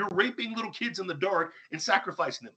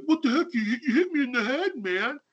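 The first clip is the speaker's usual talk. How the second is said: high, loud mock protest; mock indignant victim; 10 semitones higher, 5 dB louder than usual. Host imitating the celebrity hit by a snowball, mock indignant. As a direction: act out startled outrage at being hit. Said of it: "and he's like".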